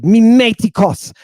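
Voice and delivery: MIMETIKOS with a high, loud stress on the last syllable; accented final syllable. Shown as a demonstration. Teacher showing students where the Greek word takes its accent, stressed ending. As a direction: hit the end of MIMETIKOS. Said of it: "hit the accent".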